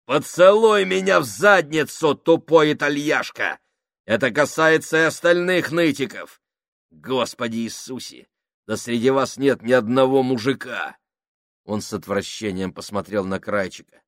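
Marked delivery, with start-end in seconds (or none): 0.1-11.0 сильно выше и громче, с презрением; ори на всех с презрением; злой крик. Acted. Сакс оскорбляет всех. (Сакс оскорбляет Фабрини и остальных, громко и зло)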